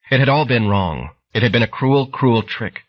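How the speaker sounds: usual speaking voice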